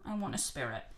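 low, soft haunted doll; creepy doll voice. Retold or imitated. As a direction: murmur it low like a cursed doll. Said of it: "she says".

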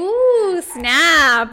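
teasing ooh. Real, not acted. Reacts to cocky comeback.

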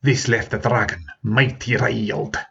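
usual speaking voice

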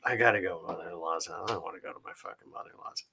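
low, quick grumble trailing to near-whisper; grumbled dread. Retold or imitated. Man voicing his reluctance to visit in-laws, muttered grumble. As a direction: grumble it under your breath, fast and low.

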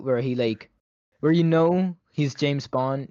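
ordinary talk, nothing marked

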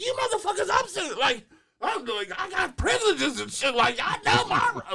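high, loud laughing rant; Madea mode yelling. Retold or imitated. Uncle voices sister ranting at police, high loud rant. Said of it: "full blown Madea mode".

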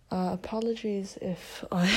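usual speaking voice

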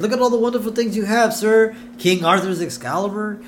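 high, eager, fawning assistant; Smithers consoling. Acted. Assistant trying to console his upset boss, high and coaxing. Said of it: "Smithers is trying to console him".

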